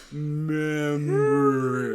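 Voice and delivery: drawn out very slowly; "Remember." stretched long and slow; slow like molasses. Retold or imitated. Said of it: "slow like molasses".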